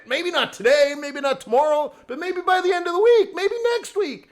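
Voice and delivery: high, urgent excited build; hyped-up. Pretend. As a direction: rattle off possibilities with rising excitement. Hyped-up trading pep talk.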